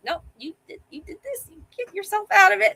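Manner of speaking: husband's stern reply. Retold or imitated. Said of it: "He's like".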